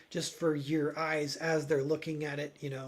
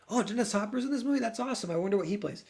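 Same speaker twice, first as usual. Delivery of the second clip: excited; 6 semitones higher, faster than usual. Retold or imitated. Retelling own excited reaction.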